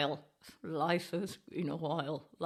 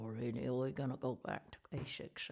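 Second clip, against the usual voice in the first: ordinary delivery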